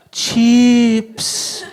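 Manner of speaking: "Cheeps" high and loud, long ee; different intonation. Shown as a demonstration. Poet tries harder to be understood, different intonation on "Cheeps". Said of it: "with a different intonation on each occasion".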